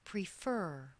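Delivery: "prefer" as pre-FER, falling on the stressed end; stress on second syllable. Shown as a demonstration. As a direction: land the weight on the last syllable of "prefer". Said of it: "when the second syllable is stressed".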